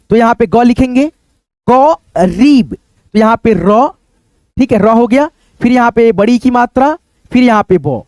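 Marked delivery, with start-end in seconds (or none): 1.7-2.7 करीब with the री vowel drawn out very long; stretched long ee. Shown as a demonstration. Teacher dictating करीब to pupils, long ee stretched out. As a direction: say करीब slowly, holding the long ee in री. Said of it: "खींच करके होता है".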